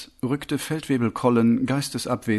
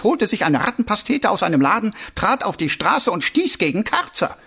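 raised-pitch officer's report; reporting captain; 5 semitones higher, 3 dB louder than usual. Acted. Captain reporting a watchman's murder to his commander, raised voice. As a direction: report up to your superior, pitch lifted.

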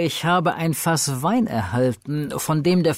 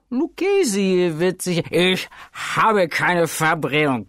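brushing it off; 4 semitones higher than usual. Acted. Fortunato waves off objections.

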